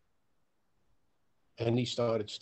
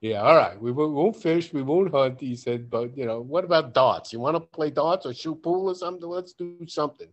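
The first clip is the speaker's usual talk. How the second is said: loud, eager; 6 semitones higher, 11 dB louder than usual. Retold or imitated. Friend's loud eager pleading.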